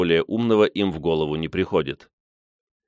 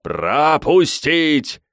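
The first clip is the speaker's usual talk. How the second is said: long drawn-out high shout; slow, roaring command; bellowed order; 8 semitones higher, 4 dB louder, much slower than usual. A narrator's reading. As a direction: stretch each syllable into a yell. Repeated bellowed order in the crowd.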